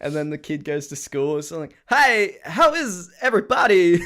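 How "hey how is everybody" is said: high, loud, slow halting impression; Christopher Walken. Kid arrives at school, Christopher Walken impression. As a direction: greet everyone in big, drawn-out halting phrases. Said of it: "a Christopher Walken accent or something".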